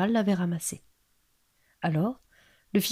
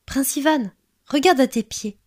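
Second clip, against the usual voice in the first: lightly voiced little creature, higher and insistent; petite voix; 5 semitones higher, 5 dB louder than usual. A narrator's reading. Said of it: "dit la petite voix". (petite voix plus aiguë qui interpelle)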